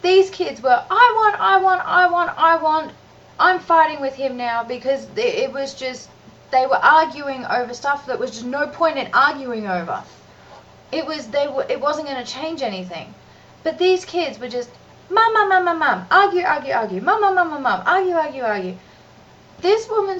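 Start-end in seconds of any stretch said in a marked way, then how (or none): 0.9-2.9 put-on high, loud whining child; whiny kids. 15.1-18.8 mock child pestering mum, higher; nagging kids.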